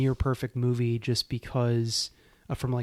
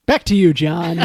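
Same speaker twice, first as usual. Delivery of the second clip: bright newsreader handoff, higher and louder; newscaster sign-off; 4 semitones higher, 13 dB louder than usual. Pretend. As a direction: toss it back like a TV anchor.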